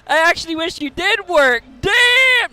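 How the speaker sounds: high, loud hyped roast; roasting shout. Pretend. Streamer loudly roasts his friend Alex's work ethic. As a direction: yell the burn like a crowd.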